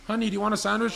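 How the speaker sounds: a hint of a sing-out mom voice; imitated mom. Mom offers her stoned daughter a snack, lightly mimicked. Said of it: "and her mom goes".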